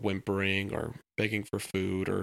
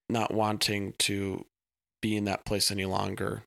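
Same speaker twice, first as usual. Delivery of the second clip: usual speaking voice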